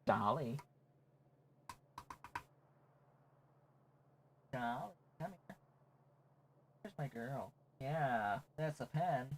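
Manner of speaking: high, very soft coaxing; squeaky soft come here; pet talk. Real, not acted. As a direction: coax the cat to come. Coaxing the cat over.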